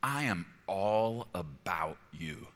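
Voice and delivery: slow, hushed tender whisper; softly devote yourself to them, barely voiced; good owner. Good owner talking to what he prizes, tender whisper. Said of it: "A good owner talks like this".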